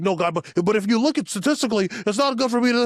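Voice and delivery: high, fast, pleading voice arguing with God; mock whiny protest.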